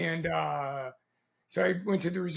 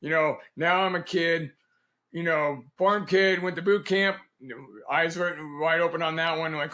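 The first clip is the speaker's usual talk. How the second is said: ordinary talk, nothing marked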